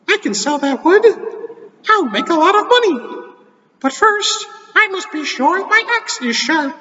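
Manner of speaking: high squeaky cartoon mouse; Mickey Mouse voice. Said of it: "I can't really do a very good Mickey Mouse".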